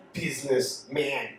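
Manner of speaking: "Businessman" said low and slow with a sour look; deadpan mock Russian "Businessman"; mock Russian accent with a grimace. Shown as a demonstration. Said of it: "this is how you say in Russian the word businessman".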